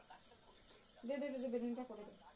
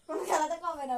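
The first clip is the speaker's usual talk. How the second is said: raised voice; 4 semitones higher, 10 dB louder than usual. Real, not acted. Wife arguing over the match.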